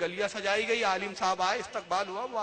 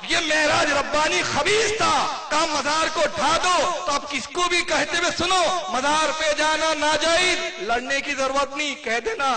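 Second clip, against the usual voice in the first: loud, high sermon shout; impassioned sermon at full volume; fiery preaching; 7 semitones higher, 7 dB louder than usual. Real, not acted. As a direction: thunder it from the pulpit.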